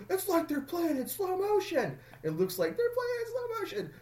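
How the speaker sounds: very high, squealed impression; mock hockey-fan yell. Driscoll complains at the Bruins game, high-pitched mimicked voice. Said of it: "say it like Uncle Brendan".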